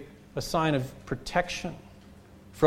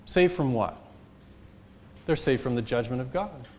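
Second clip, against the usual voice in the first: ordinary delivery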